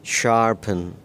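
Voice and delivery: शार्पन with a weak uh in the last syllable, neither पन nor पेन; teacher demo of शार्पन, unstressed ending; model pronunciation. Shown as a demonstration. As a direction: say शार्पन ending in a light uhn. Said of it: "बिल्कुल हल्की सी ई की आवाज़ आएगी".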